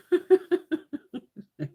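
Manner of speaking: a high burst of laughter; laughing. Real, not acted. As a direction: crack up at your own threat. Laughs at own threat.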